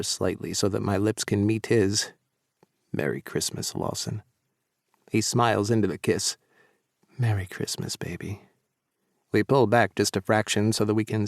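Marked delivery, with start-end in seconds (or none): 2.9-4.2 soft and low, close; tender. Acted. Soft tender Christmas wish. 7.2-8.4 soft and fading, close; tender. Acted. His soft reply in kiss.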